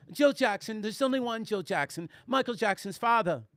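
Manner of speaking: quiet imitation of a British woman; British accent. Woman setting him straight on [name], mock British accent. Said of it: "she had a British accent".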